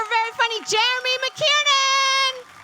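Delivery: normal talking voice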